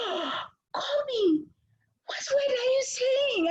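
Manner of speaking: high, theatrical fake outrage; mock outrage. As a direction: pretend to be scandalised for the kid's fun. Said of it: "I just performed like, "I'm so outraged".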